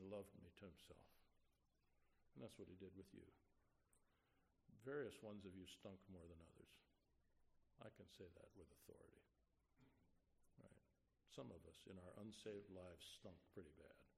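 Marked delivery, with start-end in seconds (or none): none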